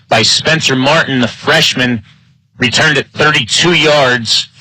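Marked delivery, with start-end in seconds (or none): none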